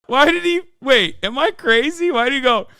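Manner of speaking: high, incredulous questions; can't believe what he just saw; baffled disbelief. Real, not acted. Streamer baffled by the debater's reaction, high and incredulous.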